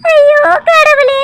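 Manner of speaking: crying wail. Acted. Niece breaks down sobbing.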